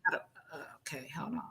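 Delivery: real whisper while setting up the video; whisper aside. Real, not acted. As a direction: whisper it to yourself while fumbling.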